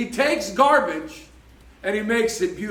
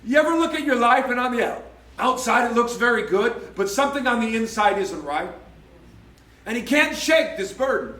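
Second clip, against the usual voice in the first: ordinary talk, nothing marked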